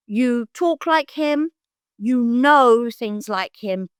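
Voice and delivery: imitating a man struck by a resemblance; awed recognition. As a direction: spell out the likeness slowly, in wonder. Retold man's awed recognition.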